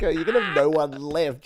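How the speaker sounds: ordinary talk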